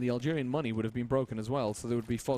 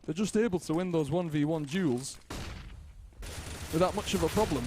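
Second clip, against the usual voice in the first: the higher co-caster voice, with the bad accent dropped; Bob without the accent; 4 semitones higher than usual. Caster retries Bob's line, higher but without the accent. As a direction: redo the line with the accent toned down. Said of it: "that's a horrible accent".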